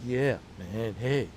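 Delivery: low, laid-back Keanu Reeves voice; Keanu impression. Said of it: "I was doing it like".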